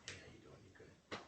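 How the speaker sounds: breathy whispered greeting, no voice; tired whisper. Retold or imitated. Greeting a visitor while too tired to talk, whispered. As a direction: barely whisper it, drained. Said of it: "my voice low, 'cause I'm tired".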